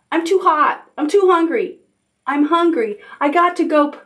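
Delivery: put-on high-pitched complaining child; little owl calling complaints. A narrator's reading. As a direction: reel off high complaints to mother. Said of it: "called Little Owl".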